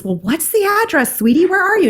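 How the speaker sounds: high, sweet mom voice; motherly questioning in a high voice; concerned mom. Retold or imitated. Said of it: "And mom says".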